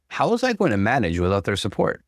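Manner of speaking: plain reading voice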